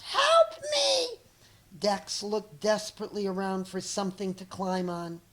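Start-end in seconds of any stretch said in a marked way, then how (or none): 0.0-1.2 very high loud screech; screeched plea. Acted. Cat dangling from a tree, screeching for help. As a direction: cry out for rescue in terror. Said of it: "Clevis screeched".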